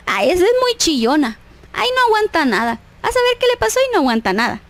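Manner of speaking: high-pitched mock disdain, rushing at the end; judgmental gossip. Woman judging a crying friend as a crybaby, dismissive. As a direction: imitate a gossip passing judgment. Voicing judgmental thoughts about a crier.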